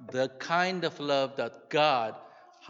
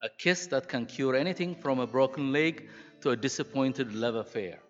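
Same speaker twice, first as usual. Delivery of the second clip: warm, steady storytelling read; story reading. Acted. Preacher reading a story about mothers to the church, warmly. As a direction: tell the tale to the congregation with feeling. Reads story aloud to congregation.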